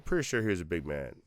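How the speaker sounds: dry mock uncertainty; sarcastic. Real, not acted. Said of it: "I'm being sarcastic".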